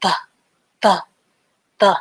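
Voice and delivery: "the" repeated, short and clipped. Shown as a demonstration. Teacher drilling "the" for a student from Poland, short. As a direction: say "the" three times, short. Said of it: "the T H is pronounced".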